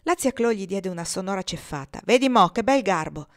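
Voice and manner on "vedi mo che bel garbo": loud sharp rebuke; scolding. Acted. Chloe scolds Moses for grabbing chicken, loud. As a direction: snap at the greedy boy.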